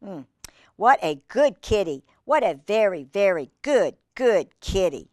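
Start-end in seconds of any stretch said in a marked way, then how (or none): none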